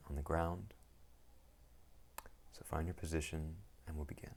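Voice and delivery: calm, unhurried meditation guide with long pauses; guided meditation. Acted. Teacher guiding listeners into settling the mind, calm meditation voice. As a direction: ease them into stillness, one phrase at a time.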